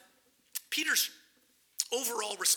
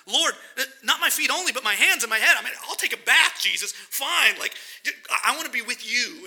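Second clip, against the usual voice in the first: louder, laughing, tumbling over himself; eager Peter; 6 dB louder than usual. Retold or imitated. Peter to Jesus, eagerly asking for a full bath, laughing. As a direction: beg to be washed all over, laughing.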